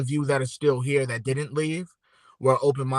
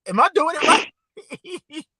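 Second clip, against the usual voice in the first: much higher, slow squeaky question; high playful question; 4 dB louder, slower than usual. Real, not acted. Host checking her hand sign on camera, high playful. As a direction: ask it in a high silly voice. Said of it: "I tried to do it in camera".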